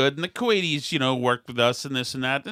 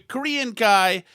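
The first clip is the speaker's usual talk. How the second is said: ordinary talk, nothing marked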